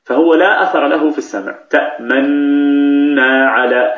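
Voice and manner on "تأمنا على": تأمنا chanted slowly with the nun merged and held, the lip rounding making no sound; slow recited تأمنا with a long nasal hum; silent ishmam. Shown as a demonstration. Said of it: "هل شعرتم بشيء؟".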